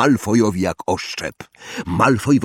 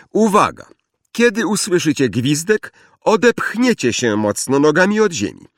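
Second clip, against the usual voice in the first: higher-pitched drill instructions to a class; commanding flying teacher; 7 semitones higher than usual. A narrator's reading. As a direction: call out orders to pupils, pitched up.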